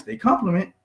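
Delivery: usual speaking voice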